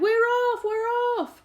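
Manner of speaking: very high, loud cheering; excited cry. A narrator's reading. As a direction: cheer together. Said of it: "the others cried".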